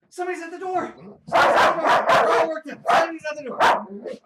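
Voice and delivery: high, loud excited dog-hyping voice; squealy excitement to rile up a dog; excited hype-up. Acted.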